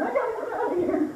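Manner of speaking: high, panicked cry of a beaten dancer; flustered guy. Retold or imitated. Bloodied guy fleeing the dance floor, high panicked squeal. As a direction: squeal it like you just got a bloody nose.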